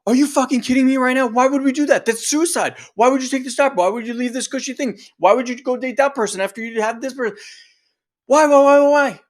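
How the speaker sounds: panicked disbelief rising to a yell; freaked-out inner voice. Retold or imitated. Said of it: "Or it's like".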